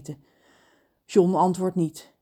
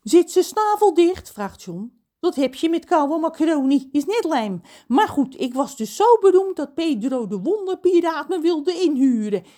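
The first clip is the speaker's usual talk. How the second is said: higher voice for the chatty cook; cook John; 8 semitones higher, 5 dB louder than usual. Acted. Cook John boasts to Ron about his fame. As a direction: tell it as the proud, talkative cook.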